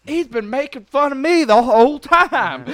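loud, high, slow mock Southern wail; Southern accent. Imagined Southern fan finds out the accent is fake, Southern accent. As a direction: play a wronged Southerner, thick accent, breaking into laughter. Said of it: "if you're a Southern guy".

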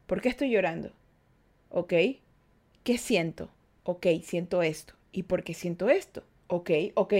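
low, soft inner monologue; reasoning with oneself. Acted. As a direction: talk yourself quietly through what you feel. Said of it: "hacer que tu cerebro se despierte".

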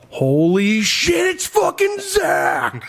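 high, slow, stunned shout of recognition; excited shout. Retold or imitated. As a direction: belt it out like spotting a celebrity. Bartender recognizes star, shouting.

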